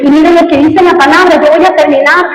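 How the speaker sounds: fiery preaching. Acted. Pastor urges his congregation to compassion, fiery preaching. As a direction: preach it hard and pushing.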